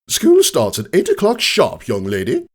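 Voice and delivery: high, stern schoolteacher voice; stern teacher. Acted. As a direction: scold the late pupil from the desk. Stern teacher scolding late pupil.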